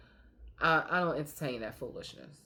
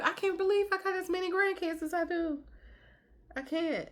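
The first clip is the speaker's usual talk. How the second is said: proud disbelief; 14 semitones higher than usual. Real, not acted. Proud disbelief about grandkids.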